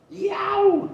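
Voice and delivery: high, loud cartoon yelp of pain; loud high yowl of pain. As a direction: yelp as if you stepped on something sharp. Burglar hurt by trap.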